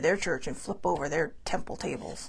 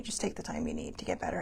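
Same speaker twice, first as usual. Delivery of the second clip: much softer, nearly whispered kindness; soft advice; 2 semitones lower, 11 dB quieter than usual. Retold or imitated. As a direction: hush it like gentle advice. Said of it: "told me".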